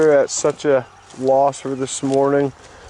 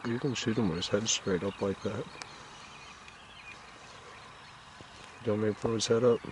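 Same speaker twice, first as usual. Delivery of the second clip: wildlife watching whisper; 4 semitones lower, 16 dB quieter than usual. Real, not acted. Whispering while hunting a bird.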